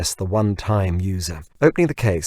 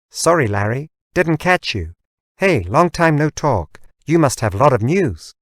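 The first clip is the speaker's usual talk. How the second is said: assistant voice; 6 semitones higher, 5 dB louder than usual. Acted. Assistant speaks.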